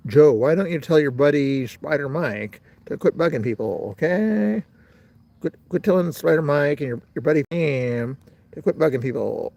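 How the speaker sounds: mock gangster from the twenties, pitch jumping on the tag question. Shown as a demonstration. Telling Joe to call off a buddy, twenties gangster voice. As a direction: play an old-time gangster ordering someone around. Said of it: "gangster from the twenties voice".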